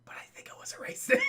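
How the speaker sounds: quick conspiratorial whisper; whispered aside. Real, not acted. Streamer jokes to chat, whispered aside. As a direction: whisper it like a secret.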